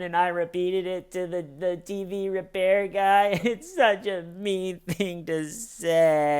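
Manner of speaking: a hint of a small child confessing; little kid voice. Imitating Wendy admitting the joke, a little kid. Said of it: "She sounds like a little kid".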